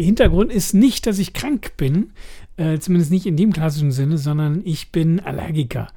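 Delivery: normal talking voice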